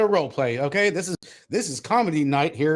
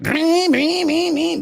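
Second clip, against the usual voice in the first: high, loud meep meep like a toy horn; imitated throttle beeping; 11 semitones higher, 6 dB louder, much slower than usual. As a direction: beep it out like a cartoon. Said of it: "it makes noises like".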